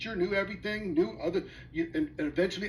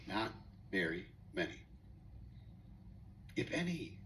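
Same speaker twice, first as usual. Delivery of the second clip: low, soft, dropped aside; quiet aside; 11 semitones lower, 13 dB quieter than usual. Real, not acted. To the audience, a quiet low aside. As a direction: mutter the answer under the breath. Answers own question aside.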